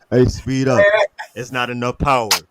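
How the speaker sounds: loud barking gym coach demanding power. Retold or imitated. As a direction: push them hard like a trainer. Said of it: "So I'm doing my coach voice".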